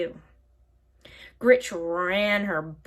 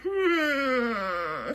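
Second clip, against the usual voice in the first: pondering witch; witch musing; 3 semitones higher than usual. A narrator's reading. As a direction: hum while tapping her wart. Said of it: "she said".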